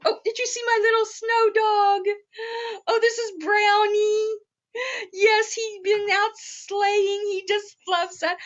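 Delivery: high, excited show-and-tell; light up showing off the dog; delighted. Real, not acted.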